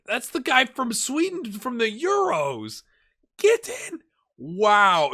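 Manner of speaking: high, loud burst of delighted recognition; thrilled, pitch shooting right up; excited outburst. Real, not acted. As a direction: shout it like your player just scored. Recognizes player, celebrates the find.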